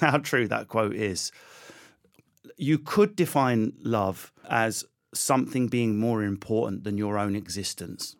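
ordinary talk

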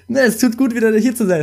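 high, loud and gleeful; excited. Real, not acted. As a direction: burst out with joy. Joyful repeat of the line.

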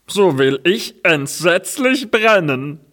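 high, dramatic dung heap voice. Acted. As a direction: vow it like a heap about to blaze. Dung heap decides to burn.

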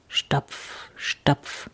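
toneless, spoken stomp sounds; stomping footsteps. A narrator's reading. Someone coming nearer the house, toneless stomping. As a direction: puff out each separate footfall. Imitates approaching heavy footsteps.

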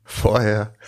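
giggles break through again; laughing fit. Real, not acted. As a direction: cannot hold back laughter. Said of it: "dieses Thema finde ich jetzt schon so lustig".

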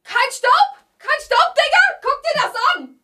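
high, squealing disbelief; shocked disbelief. Real, not acted. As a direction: squeal it in outrage. Mock outrage about no dust.

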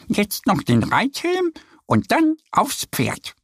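high, slow duck voice calling out; eager to get him on the horse; calling little duck. A narrator's reading. Annie calling Mo to mount, high duck voice. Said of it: "ruft Annie".

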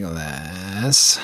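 low creaky fry, slowed right down; vocal fry. Shown as a demonstration. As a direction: drag the words out in a creaky fry. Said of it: "Give me an example of vocal fry".

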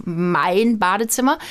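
mein deliberately emphasized before Badezimmer; stress on mein. Shown as a demonstration. Mother pointing out whose bathroom it is, stressing mein. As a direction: punch the possessive mein. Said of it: "Die Betonung liegt auch auf".

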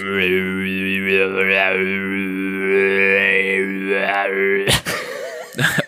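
long, helpless real laughter; laughing at a friend's boast. Real, not acted. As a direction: laugh until it almost turns to crying. Mocking disbelief at co-host.

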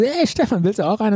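lightly voiced crush offering a cigarette; mock sweet girl voice.

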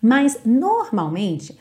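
usual speaking voice

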